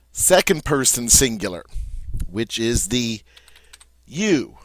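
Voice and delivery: ordinary talk, nothing marked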